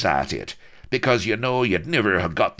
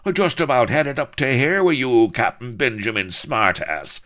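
loud, high taunting pirate challenge; furious swaggering pirate throwing down a challenge; raging pirate colonel; 10 semitones higher, 4 dB louder than usual. A narrator's reading. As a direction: bellow the insults at the dandy captain. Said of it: "the raging colonel".

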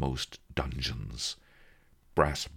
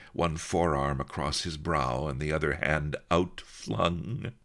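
plain reading voice

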